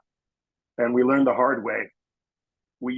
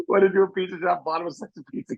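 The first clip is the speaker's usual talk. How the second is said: ordinary talk, nothing marked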